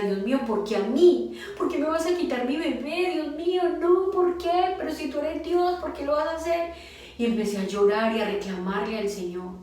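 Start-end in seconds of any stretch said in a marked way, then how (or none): 0.0-6.7 high, pleading protest to God; retold pleading complaint, pitch climbing steeply; anguished reproach. Bereaved mother reproaching God over her lost baby, anguished. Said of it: "le reclamaba y le decía".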